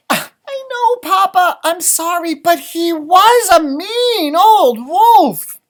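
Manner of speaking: high, loud pleading chick voice; little chicken voice. A narrator's reading. Chicken pleads with Papa, high little voice. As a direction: insist up high and small.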